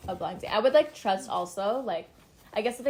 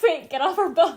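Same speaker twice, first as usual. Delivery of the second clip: loud, high, laughing through it; laughing mock yell; 8 semitones higher, 10 dB louder than usual. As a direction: yell it while cracking up. Boat owners kick girls off.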